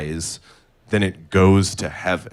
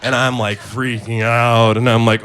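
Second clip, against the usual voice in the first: usual speaking voice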